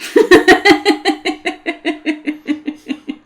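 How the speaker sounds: high, loud peal of laughter; helpless laugh. Real, not acted. Caught out on a bad memory, laughing hard. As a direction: burst out in a long run of giggles.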